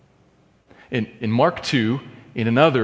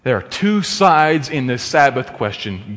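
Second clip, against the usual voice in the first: ordinary talk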